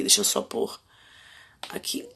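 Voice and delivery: quick whisper while fixing something; whispered aside. Real, not acted. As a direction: drop to a whisper, off-mic. Aside while fixing live settings. (sussurro rápido, à parte)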